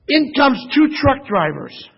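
ordinary talk, nothing marked

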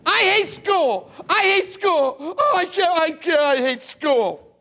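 put-on high, loud, whining teen, near crying; whiny bad attitude. Preacher mocks a teen who hates school, whiny and near tears. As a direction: whine it like a sulky teenager. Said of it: "If you got a bad attitude".

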